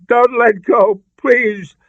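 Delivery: high, loud frightened pleading; panicked begging; alarmed plea. A narrator's reading. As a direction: beg him not to let go. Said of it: "Alarm riddled her voice".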